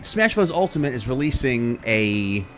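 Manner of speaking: normal talking voice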